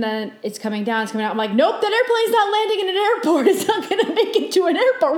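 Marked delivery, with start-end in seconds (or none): 1.5-5.2 high, insistent retold alarm; freaking out. Dreamer watching a plane come down, alarmed. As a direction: re-enact mounting alarm with raised pitch. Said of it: "I'm freaking out".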